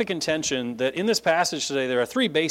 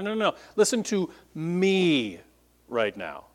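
a hint of Paul cutting through the noise, pressing "listen"; mock insistent call over a crowd; emphatic plea; 4 semitones higher than usual. Paul quieting a chanting crowd, lightly voiced insistence. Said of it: "There's an emphasis in there".

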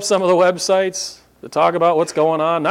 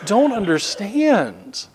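higher, incredulous questioning; baffled disbelief; 7 semitones higher, 3 dB quieter, slower than usual. Retold or imitated. Preacher acting out his reaction to the mayor, higher baffled disbelief. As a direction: sound lost and confused, pitch up. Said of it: "where I went".

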